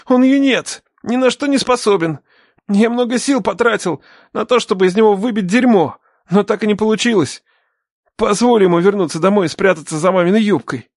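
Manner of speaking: high, insistent begging; desperate pleading. A narrator's reading. Captive begs the father to release his son, desperate.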